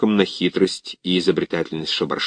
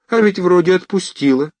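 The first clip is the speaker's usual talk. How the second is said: surprised relief; 9 semitones higher than usual. Acted.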